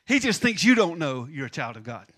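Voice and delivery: ordinary talk, nothing marked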